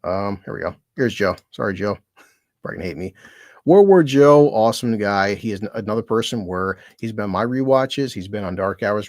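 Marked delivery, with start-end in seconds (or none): 3.7-4.5 big, loud announcer-style name call; hype intro. Real, not acted. Introducing his buddy to viewers, loud hyped name call.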